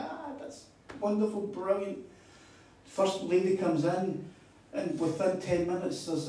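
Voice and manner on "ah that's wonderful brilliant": retold delight in a higher voice; high, mildly gushing approval; pleased response. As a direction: act out a pleased, high-pitched reply. Acting out his pleased response.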